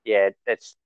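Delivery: ordinary talk, nothing marked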